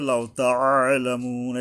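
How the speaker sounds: slow তাআলামুন with a throaty "'a"; careful ayn. Shown as a demonstration. Teacher cautions learners, তাআলামুন with careful ayn. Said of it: "এই আইন উচ্চারণে সাবধান".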